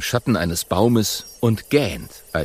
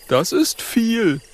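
high, slow awed voice; high little dragon, awed; impressed little dragon; 14 semitones higher, slower than usual. Acted. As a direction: say it in childlike awe. Oskar impressed.

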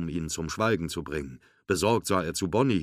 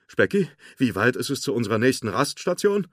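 urgent calling; 5 semitones higher, 6 dB louder than usual. A narrator's reading. Urgent question after Forky's escape.

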